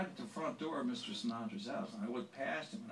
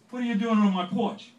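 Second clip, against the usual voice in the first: loud, high scolding demand; loud and high, like a star catching a trespasser; hammed-up angry celebrity voice, loud and high; 10 semitones higher, 11 dB louder than usual. [name] voiced in story, gruff.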